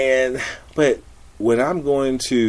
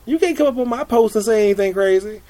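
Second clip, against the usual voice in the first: indignant; 13 semitones higher, 7 dB louder than usual. Retold or imitated. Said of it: "And so I'm like".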